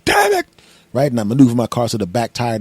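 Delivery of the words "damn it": high, strained comic outburst; exaggerated high-pitched swearing; cursing. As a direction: yelp the curse in frustration. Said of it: "and I'm cursing".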